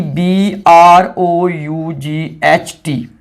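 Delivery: "B R O यू जी H T" spelled letter by letter, very slowly; slow spelling out. Shown as a demonstration. Teacher spelling the new ending for students, very slow. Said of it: "अब यही इसकी third form भी बनेगी".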